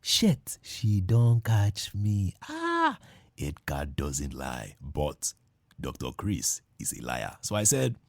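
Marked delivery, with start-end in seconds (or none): none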